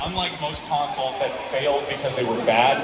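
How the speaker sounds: normal talking voice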